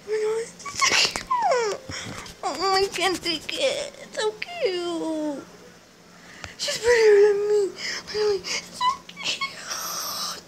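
high, slow, tearful gushing; overwhelmed, near-sobbing with delight; crying with joy. Real, not acted. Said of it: "I'm actually crying right now".

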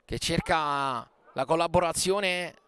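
ordinary talk

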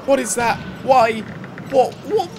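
high-pitched, startled questions; alarmed. Real, not acted. Alarmed at something seen in game.

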